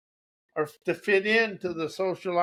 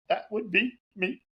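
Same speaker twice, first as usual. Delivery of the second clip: ordinary delivery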